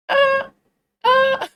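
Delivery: shrieking very high and loud. Shown as a demonstration. Retrying the Miku pitch for the listener, very high and loud. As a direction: strain for the top of the range. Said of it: "Uh, try again".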